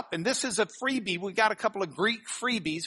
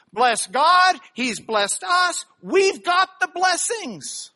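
louder and higher, rising to a climax; excited preaching; 8 semitones higher, 6 dB louder, slower than usual. Acted. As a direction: build it up into a joyful shout. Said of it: "Paul's harping on this word".

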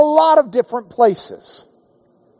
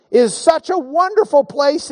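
normal talking voice

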